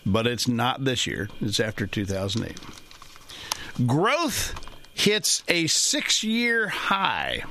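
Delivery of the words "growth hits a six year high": headline read. Shown as a demonstration. Reading a headline aloud.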